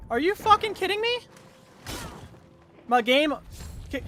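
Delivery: very high, loud scream of disbelief; outraged yell. Real, not acted. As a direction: yell it in real, furious disbelief. Game kicked player out mid-fight.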